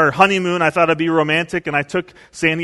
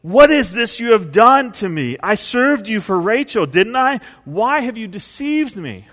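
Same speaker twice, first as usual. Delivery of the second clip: upset accusation; 5 semitones higher than usual. Retold or imitated. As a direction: confront the father-in-law, wronged. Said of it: "he gets really upset".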